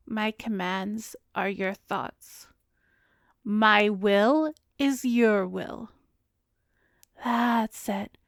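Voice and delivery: slow, drawn-out trance chant; vampire intoning her will over her thrall; slow hypnotic mantra. Acted.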